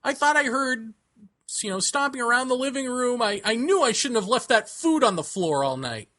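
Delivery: lightly mock her breezy excuse; imitating friend Melissa. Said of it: "And she's like".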